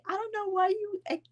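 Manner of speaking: a hint of the calm husband, up high; husband's voice. Retelling how her husband calmed her, lightly voiced. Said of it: "He's like".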